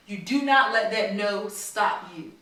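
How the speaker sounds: plain reading voice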